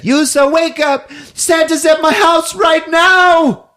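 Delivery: very high, loud yelling, stretching the end; mock wake-up shout. [name] shouts at the kid asleep in bed, high urgent mock yell.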